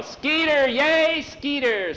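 high, drawn-out crowd cheer; yelling. A narrator's reading. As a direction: cheer on the hero from the stands. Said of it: "beginning to yell".

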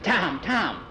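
put-on a boy calling out. Skeeter calls to Tom.

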